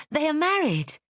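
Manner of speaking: high, bright hopeful exclamation; excited cry. Acted. Jane interrupting the letter, excited cry. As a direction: burst out in eager hope. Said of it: "cried Jane".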